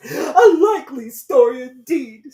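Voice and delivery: very high, loud, slow scornful sneer; deepest contempt. A narrator's reading. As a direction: scoff at her story. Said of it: "in a tone of the deepest contempt".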